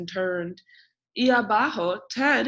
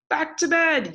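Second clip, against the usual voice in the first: high, loud call of back to bed; shouting; 8 semitones higher, 5 dB louder than usual. A narrator's reading. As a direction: call it out loud across the house. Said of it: "yelled Fred".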